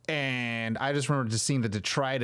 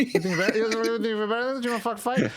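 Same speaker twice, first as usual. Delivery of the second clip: higher, fast jokey tough talk; mock challenge; 8 semitones higher, faster than usual. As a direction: egg them on in play. Mock fight again, rising.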